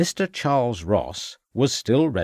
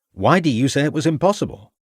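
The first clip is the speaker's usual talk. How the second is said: higher-pitched puzzled question; perplexed; 6 semitones higher, 3 dB louder than usual. A narrator's reading. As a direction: ask in mild bafflement, pitch lifted. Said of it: "asked a perplexed Ravenscroft".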